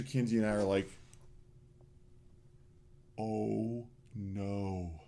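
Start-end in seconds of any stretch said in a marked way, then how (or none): 3.2-5.0 low, slow, hushed "oh no"; mock dread, drawn out and soft; sinking dismay, kept low and slow; retold dismay. Retold shocked reaction to spill.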